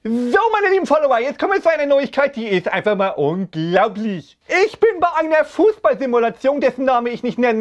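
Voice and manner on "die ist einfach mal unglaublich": dramatic deep voice. Acted. Dramatic announcement of news.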